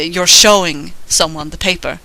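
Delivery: ordinary delivery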